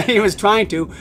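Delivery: chuckling as it is said; amused. Real, not acted. Amused answer to listener's joke.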